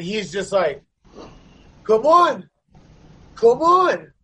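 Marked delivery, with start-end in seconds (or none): 1.8-4.1 high, loud repeated challenge; goading taunt. Retold or imitated. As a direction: egg him on, pitched up and louder. Retold stage-diver goading him to fight.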